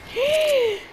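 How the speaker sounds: sharp, high-pitched gasp. Real, not acted. Gamer startled mid-fight, high sharp gasp. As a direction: suck in air suddenly, high. Startled during live game.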